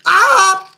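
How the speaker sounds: short, loud high yelp; piercingly loud "ah"; startled scream. Real, not acted. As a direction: let out a sudden high scream. Mishap while moving the train.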